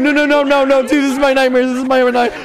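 high, loud, frantic pleading; high-pitched panicked babble; freaking out. Real, not acted. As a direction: wail it like a living nightmare. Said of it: "I'm freaking out".

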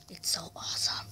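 whisper. Real, not acted. Gleeful aside about axes.

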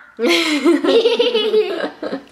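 high giggling burst of laughter; breathless laugh. Real, not acted. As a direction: crack up laughing after the long note. Breaking up after long held vowel.

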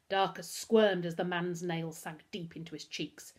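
usual speaking voice

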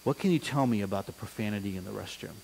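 low, soft, grave questioning; a principal turning serious on a student; stern and serious. Retold or imitated. Principal getting serious with a fifth grader, low and grave. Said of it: "Get real serious".

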